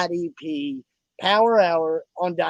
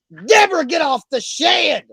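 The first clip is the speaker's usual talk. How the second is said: a hammed-up yell, pitched higher and slowed; mock shout; 10 semitones higher, 6 dB louder than usual. Host hollering about his birds on the show, mock shout. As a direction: bellow it out like calling across the yard, drawn out.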